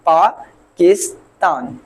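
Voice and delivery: पाकिस्तान slowly in three beats, paa, kis, taan; teacher separating each syllable of पाकिस्तान; split into syllables. Shown as a demonstration. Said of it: "तीन".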